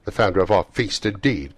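loud, scornful echo of a toast; indignant cry. A narrator's reading. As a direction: throw the toast back indignantly. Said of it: "cried Missus Cratchit, reddening".

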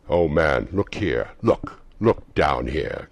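loud, slow urgent ghostly plea; exclaiming ghost. Acted. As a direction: urge him to look down. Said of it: "exclaimed the ghost".

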